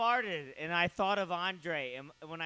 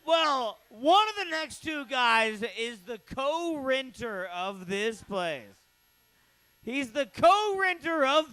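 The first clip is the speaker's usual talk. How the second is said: hype announcer; 7 semitones higher, 5 dB louder, slower than usual. Real, not acted. Host hypes next comic.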